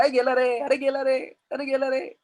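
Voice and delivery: high, hammed-up wailing of kids; mock wailing. Teacher acting out Pradeep's children wailing as he leaves, mock wail. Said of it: "चिल्ला चिल्ला के रो रहे".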